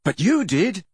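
high, protesting retort; angry. A narrator's reading. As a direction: throw it back at him. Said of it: "said Harry angrily".

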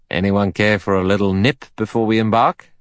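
hearty, bluff offer of a drink; a touch of breezy hospitality, inviting everyone in; hospitable. A narrator's reading. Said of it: "asked Mister Davis hospitably".

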